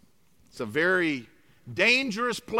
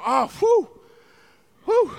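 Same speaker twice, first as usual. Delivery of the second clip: light sigh of relief, a bit higher; mock relieved whew; 8 semitones higher, 4 dB louder than usual. Russians relieved at the headline, mock relieved whew. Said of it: "They go".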